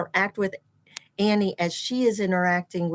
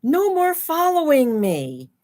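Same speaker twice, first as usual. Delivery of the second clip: put-on firm little girl; 5 semitones higher, 5 dB louder than usual. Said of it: "Annie is saying to the one little baby".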